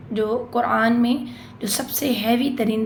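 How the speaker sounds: ordinary delivery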